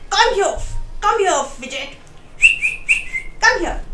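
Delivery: high calls across the field; calling a dog. A narrator's reading. Farmer calling his runaway dog.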